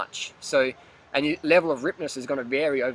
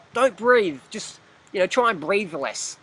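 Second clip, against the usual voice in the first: higher-pitched mock instruction; mocking advice; 5 semitones higher, 3 dB louder, slower than usual. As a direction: imitate absurd advice, starting loud then slowing. Mocks absurd advice on limiting.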